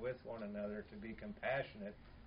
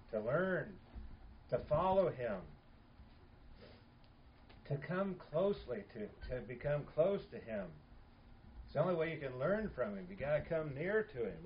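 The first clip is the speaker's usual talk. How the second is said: rising preaching; 2 semitones higher, 4 dB louder than usual. Real, not acted. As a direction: push the call harder line by line. Preaching builds in force.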